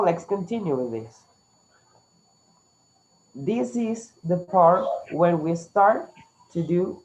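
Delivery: ordinary talk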